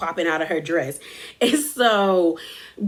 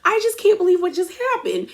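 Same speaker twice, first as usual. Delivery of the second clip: high-pitched imitation of crying complaint; mock tearful; 9 semitones higher, faster than usual. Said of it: "she starts crying".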